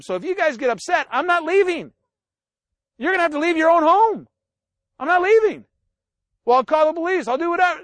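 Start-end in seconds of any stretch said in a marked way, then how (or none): 1.1-5.7 higher-pitched, lightly acted stand-off; retold refusal, pitched up; mock defiance. Preacher replaying a quarrel with family, mock defiant. 6.4-7.8 higher-pitched imitated relative; retold quarrel threat, lightly acted; mock threat. Imitating a relative threatening to call police, higher and indignant.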